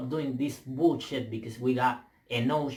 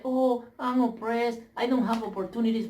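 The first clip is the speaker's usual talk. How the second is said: mocking whine; 9 semitones higher than usual. Vlogger imitating people who play victim, high mocking whine. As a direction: parody a self-pitying complaint. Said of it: "They never acting like".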